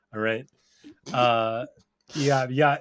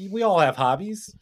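usual speaking voice

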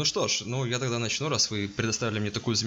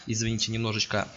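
ordinary delivery

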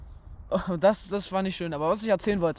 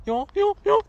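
put-on old man voice; 13 semitones higher, 5 dB louder than usual. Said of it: "Diesen alten Opa".

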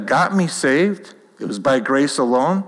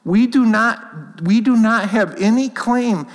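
fervent preaching; 8 semitones higher, slower than usual. Real, not acted. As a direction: drive it home from the pulpit. Preaching at full force.

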